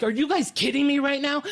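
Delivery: high-pitched indignant protest; retold outrage. To ushers blocking the door, retold outrage.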